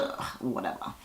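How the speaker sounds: mocking a bored pregnant woman, muttered aside; dismissive ugh. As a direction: brush it off under your breath. Dismissing Portia's mock question.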